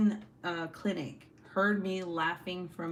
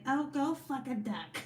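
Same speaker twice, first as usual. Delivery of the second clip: higher, lightly put-on older lady's voice; cute older lady; 6 semitones higher than usual. Streamer imitates therapist telling off stepdad, cute older lady. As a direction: mimic a sweet older lady cussing. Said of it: "she's so cute".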